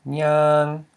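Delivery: nyang with a long vowel on a mid tone. Shown as a demonstration. Teacher recalls the word for walk, nyang held long. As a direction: draw nyang out, long and level. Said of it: "the word we had before for to walk".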